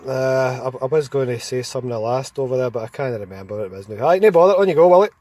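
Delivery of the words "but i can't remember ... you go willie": ordinary delivery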